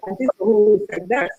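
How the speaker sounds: ordinary talk, nothing marked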